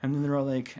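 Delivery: ordinary delivery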